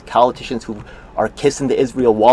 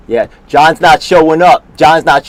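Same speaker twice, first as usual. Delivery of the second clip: loud, slow jeer; taunting call-out; 4 semitones higher, 9 dB louder, slower than usual. Real, not acted. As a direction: call it out slowly and loudly. Taunting absent colleague, calling out.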